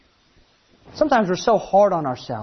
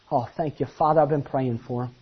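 Jesus voiced; 8 semitones lower, 6 dB quieter than usual. Jesus voiced thanking Father.

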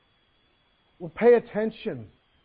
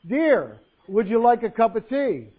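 loud, higher, insistent question; firmer voice; 4 semitones higher, 7 dB louder, slower than usual. Acted. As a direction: repeat it firmly and louder. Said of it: "said again in a firmer voice".

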